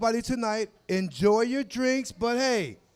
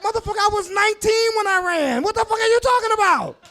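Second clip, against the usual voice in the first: high, loud indignant outburst; outraged protest; 10 semitones higher, 5 dB louder than usual. Retold or imitated. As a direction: protest the cops' claim, fed up. Outraged quote to cops.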